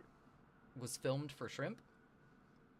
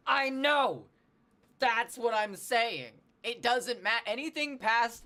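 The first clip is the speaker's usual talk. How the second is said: loud, high, drawn-out protest; real exasperation; 10 semitones higher, 8 dB louder, slower than usual. Real, not acted. Streamer protesting to a chatter who corrected her, exasperated. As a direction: insist loudly, stretching the words.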